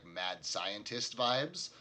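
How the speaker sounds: normal talking voice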